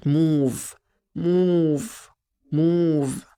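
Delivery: each موف held long, like two O's together; long double O. Shown as a demonstration. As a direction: draw out the oo in موف. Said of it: "كأن اتنين او مع بعض". (مدّ الـ oo في «موف»)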